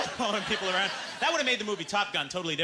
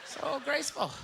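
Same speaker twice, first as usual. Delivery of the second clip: gushing; 11 semitones higher, slower than usual. Acted. Mock gushing.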